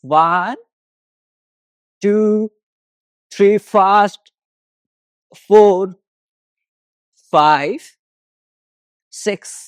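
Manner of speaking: drawn-out single counts with pauses between; exercise count-off. Real, not acted. Yoga teacher counting walking steps for the class, instructor's count-off.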